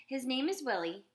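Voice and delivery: proud claim. A narrator's reading. Children claim the dog.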